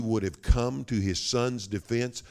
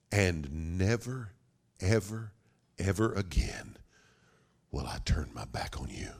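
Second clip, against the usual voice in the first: solemn promise; 3 dB quieter than usual. Acted. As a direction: pause after each "ever", drop to a hush. Father's solemn promise, first take.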